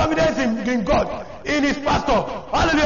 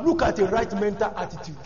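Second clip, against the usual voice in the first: ordinary talk, nothing marked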